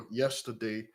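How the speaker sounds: normal talking voice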